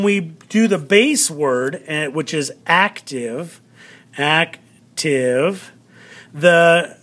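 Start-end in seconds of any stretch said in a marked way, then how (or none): 2.7-3.6 "active" with weight on the first syllable; accent the opening AC of "active"; demonstrative AC-tive; stress on AC. Shown as a demonstration. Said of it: "accent is actually on the very beginning". 4.2-5.7 "active" split into AC and tive; teacher separating AC, pause, tive; syllable by syllable. Shown as a demonstration. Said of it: "accent is actually on the very beginning".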